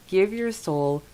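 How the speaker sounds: ordinary delivery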